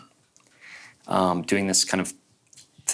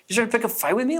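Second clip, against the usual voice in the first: high-pitched, rising incredulous question; incredulous challenge; 12 semitones higher, faster than usual. Retold or imitated. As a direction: challenge them in mock disbelief, pitch way up. Repeats the acted challenge.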